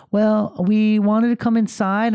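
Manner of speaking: put-on high, slow child's excuse; drawn-out mimicked kid voice; imitated daughter. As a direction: explain like a little girl caught out. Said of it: "And she's like".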